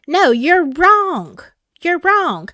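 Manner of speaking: slow, drawn-out mocking brush-off; mock church leader dismissing a warning; mock dismissal. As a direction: imitate someone waving you away.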